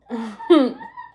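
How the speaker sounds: short high laugh; a brief burst of high laughter. Real, not acted. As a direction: let out a quick laugh. Laughing at someone's antics on stream.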